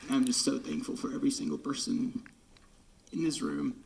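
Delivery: ordinary delivery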